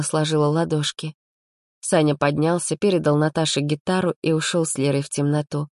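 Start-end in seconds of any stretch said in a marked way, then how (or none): none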